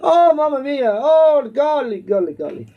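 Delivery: hammed-up, amateur Italian accent; animated mock Italian accent, rising and falling. Shown as a demonstration. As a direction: ham it up as a cartoon Italian. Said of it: "there's that Italian".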